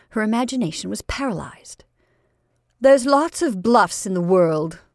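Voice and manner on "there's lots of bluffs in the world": falsely pleasant. Acted. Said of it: "continued Marjorie quite pleasantly".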